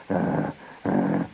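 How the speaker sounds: very low growled "ah"; repeated low, throaty lion grunts; imitated lion. Storyteller imitating the lion behind the tamer, low growls. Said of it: "They got a different sound".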